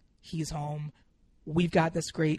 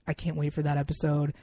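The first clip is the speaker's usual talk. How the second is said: normal talking voice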